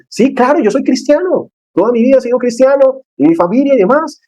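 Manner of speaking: high, breezy mock voice of the friend; offhand friend. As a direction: toss it off casually. Friend casually admits faith.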